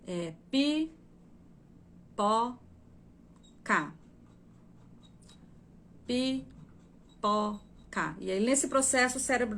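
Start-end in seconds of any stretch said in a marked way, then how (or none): 0.1-3.9 pi, pó, cá split into separate syllables with long pauses; reading syllable by syllable. Shown as a demonstration. Teacher showing a child sounding out pi, pó, cá slowly. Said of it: "ela tá lendo assim". 6.1-8.1 Pi, pó, cá said as separate halting syllables; a child sounding out a new word; reading syllable by syllable. Shown as a demonstration. Teacher repeating a child's halting syllable reading. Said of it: "ela tá lendo assim".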